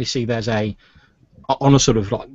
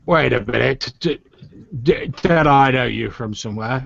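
lightly voiced drunk, halting; drunk man. Drunk man accosting Nathaniel, lightly slurred recognition. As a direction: stumble over the words like a mild drunk. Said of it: "a really sort of drunk man".

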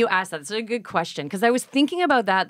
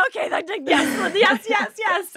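high, giddy yeses through laughter; squealing happy laughing yeses; laughing with joy; 12 semitones higher than usual. Retold or imitated. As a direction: gush yes while laughing in disbelief. Thrilled reaction to nomination news.

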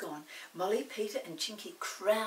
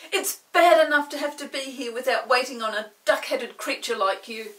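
furious; 4 semitones higher, 12 dB louder than usual. Acted. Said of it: "said Peter furiously".

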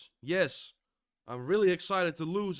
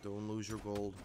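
lower, softer self-talk; calming himself down; 8 semitones lower, 8 dB quieter than usual. Real, not acted. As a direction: talk yourself out of the rage quietly. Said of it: "When I'm pissed, I'm getting inner focus".

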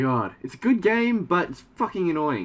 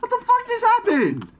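very high, startled shriek; shocked shriek; 4 dB louder than usual. Real, not acted. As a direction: yelp in shock. Sudden death in game.

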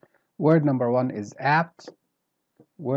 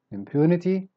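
"Impunity" higher on "pu"; middle stress; 5 semitones higher than usual. Shown as a demonstration. Teacher repeating for learners, "Impunity" with middle stress. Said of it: "Starts in the middle".

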